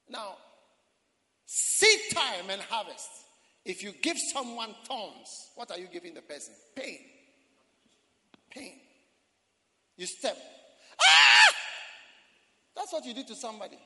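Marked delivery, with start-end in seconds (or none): 1.5-3.1 slow and loud, each word weighted; drawn-out proclamation. Acted. Preacher proclaiming seed time and harvest slowly. 11.0-11.6 sudden high yelp of pain; sharp pained cry. Acted. Preacher acting out stepping on a thorn, yelping.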